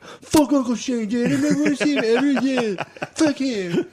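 high, loud mock-outraged yell; outraged son. Imagined son disowning his uncle over a podcast, high mock outrage.

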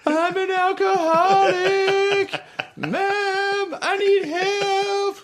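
very high, slow, drawn-out sobby wail; hammed-up crying confession to mom; mock banshee wailing. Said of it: "crying like a fucking banshee".

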